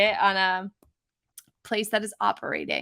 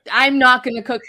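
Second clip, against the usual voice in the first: defiant retort, louder; 2 semitones higher, 8 dB louder than usual. Retold or imitated.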